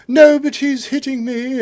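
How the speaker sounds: very high, plaintive British-Australian voice; British-Australian whine. Retold or imitated. British roommate complaining to police, British-Australian whine. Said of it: "he's this British guy".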